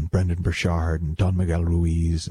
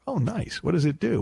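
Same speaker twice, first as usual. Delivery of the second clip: impressed passer-by; 9 semitones higher than usual. Pretend. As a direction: play the admiring stranger, pitched up. Imagined dog-owner chat.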